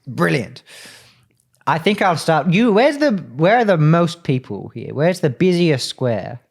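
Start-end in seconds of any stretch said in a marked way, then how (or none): none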